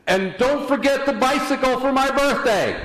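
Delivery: high, loud shout, imitating a little boy; shouted prayer. Little boy praying so Grandma hears, sudden high shout. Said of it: "suddenly he looked up and he shouted".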